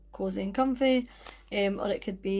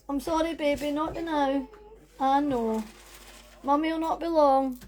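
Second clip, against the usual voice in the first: soothing mummy voice; 5 semitones higher than usual. Real, not acted. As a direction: reassure your little one gently. Soothing her child being sent out.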